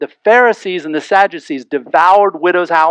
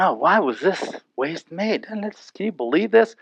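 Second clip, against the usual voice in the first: indignant murmur; 7 dB quieter than usual. Retold or imitated. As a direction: mutter it like an offended guest at the table. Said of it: "maybe in their head or under their breath".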